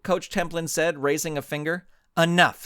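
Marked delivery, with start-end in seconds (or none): 2.2-2.6 sharp, louder single word; snapped. A narrator's reading. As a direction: cut him off hard. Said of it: "Suprema snapped".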